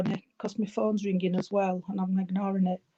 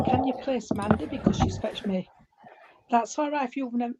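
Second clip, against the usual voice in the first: usual speaking voice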